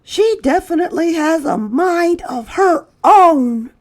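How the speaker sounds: put-on high, loud boy voice; a boy remarking on the new girl, high and loud; boy's voice. A narrator's reading. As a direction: say it high like a bemused kid. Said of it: "said Chester".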